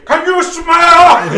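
high, slow drawn-out catchphrase; Rock impression. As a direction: drag it out like a wrestler. Imitating The Rock's catchphrase.

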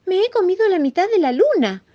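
high, loud distressed mouse; anguished confession. Acted. Mouse confesses to the rabbit what he ate, anguished confession. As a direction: wail it in a small high voice. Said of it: "bastante angustiado".

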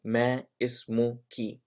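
اسمك ending in "ki", like English key; feminine ki ending. Shown as a demonstration. Teacher showing how to ask a woman, اسمك ending "ki". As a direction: say اسمك with a final "ee". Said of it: "If I'm talking to a woman".

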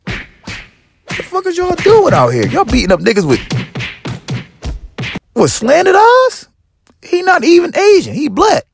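high, strained incredulous yell; yelling at attackers. Retold or imitated. Comic yelling at a crowd chasing his friend, high and strained. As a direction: shout across the street that he's not even Chinese.

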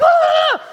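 very high, loud mock scream; screaming meltdown. Pastor acting peak panic, high scream. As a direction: top it with a full shriek.

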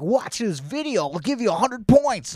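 high, bright promo pitch; mock announcer voice. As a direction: sell it like an ad. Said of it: "they'll be like".

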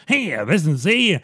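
hammed-up old-movie fast talker; fast-talking screwball comedy. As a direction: rattle it off like a 1930s wisecracker. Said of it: "screwball comedy from the thirties".

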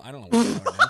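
real laughter; laughing. Real, not acted. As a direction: laugh through the confusion. Laughing confusion over names.

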